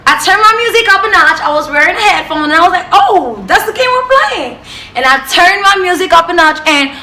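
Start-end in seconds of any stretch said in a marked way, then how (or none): 2.9-4.6 high, drawn-up mock realisation; challenging retort. Insulted passenger answering the woman wiping herself, challenging. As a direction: take up the challenge, pitch soaring.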